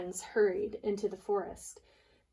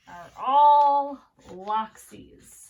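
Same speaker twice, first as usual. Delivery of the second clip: drawn-out ending; 3 semitones higher, 9 dB louder, much slower than usual. Shown as a demonstration. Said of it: "teacher stretches word for class".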